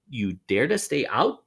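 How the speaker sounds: plain reading voice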